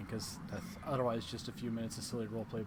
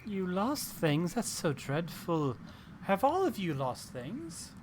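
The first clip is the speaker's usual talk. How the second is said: slow hag voice; 5 semitones higher, 4 dB louder, slower than usual. Acted. GM voices old frog hag.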